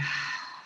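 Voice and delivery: short sighing out-breath; energizing breath released softly; audible exhale. Shown as a demonstration. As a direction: let the breath go in one audible sigh.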